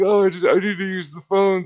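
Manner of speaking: high, loud imitated plea; pleading stranger. Trespasser explaining himself to the homeowner, pleading imitation.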